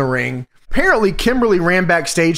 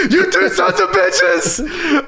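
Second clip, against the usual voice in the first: high-pitched hammed-up yelling; imitating a furious, screaming outburst; mock enraged shout; 14 semitones higher, slower than usual. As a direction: bellow it like a raging wrestler. Acting out wrestler's sudden rant.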